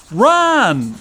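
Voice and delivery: urgent shout to run; yell. A narrator's reading. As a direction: shout it out in alarm. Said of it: "yelled Art".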